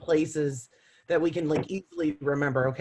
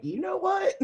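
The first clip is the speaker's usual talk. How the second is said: much higher, mock indignant comeback; defiant retort. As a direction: fire back like someone just told you to calm down. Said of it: "you're like".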